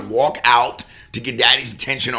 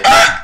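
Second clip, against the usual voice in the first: loud retold angry yelp; sharp frustrated cry, louder than the talk; angry outburst; 8 dB louder than usual. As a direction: let out a sudden angry ah. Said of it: "You can see me just getting hot".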